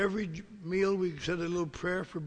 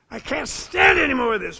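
desperate outburst; 7 semitones higher, 12 dB louder than usual. Retold or imitated. Desperate retold inner outburst.

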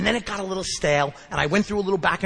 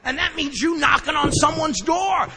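higher, loud, slow and punched; emphatic; 9 semitones higher, 3 dB louder than usual. Shown as a demonstration. Telling the group what willingness sounds like, loud and slow. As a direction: hammer every word home. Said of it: "Willingness has a sound".

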